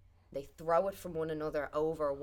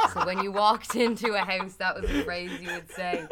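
laughing; 3 semitones higher, 9 dB louder than usual. Real, not acted. Laughing after the punchline.

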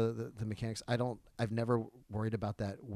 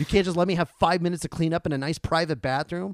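loud, high-pitched, indignant rant; retold rage; 6 semitones higher, 9 dB louder than usual. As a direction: blow up at the old man in disbelief. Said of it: "I just fear this rage".

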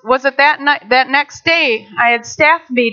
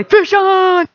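excited shout; 6 semitones higher than usual. Retold or imitated. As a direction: cry out as the line goes tight. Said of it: "Fish on, basically".

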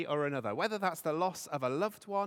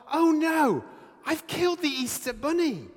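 panicked driver; 10 semitones higher, 6 dB louder than usual. Acted. Driver panics over dead bunny.

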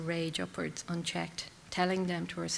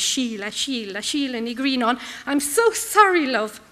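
grieving call; 3 semitones higher, 8 dB louder than usual. Acted. Grieving over a dead friend.

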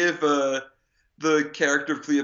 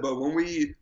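ordinary talk

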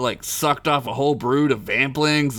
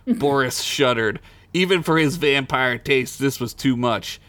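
usual speaking voice

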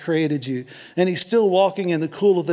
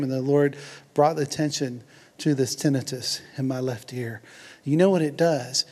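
usual speaking voice

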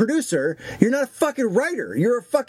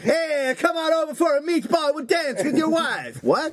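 higher, hammed-up Eastern Bloc accent; a hammed-up Eastern European accent; Borat-like accent; 6 semitones higher than usual. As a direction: ham up a Borat-style foreigner. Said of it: "now you're starting to sound like Borat".

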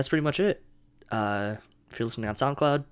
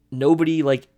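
much louder, higher burst; emphatic shout; 5 semitones higher, 10 dB louder than usual. Real, not acted. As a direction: bark it out. Ranting about a boat.

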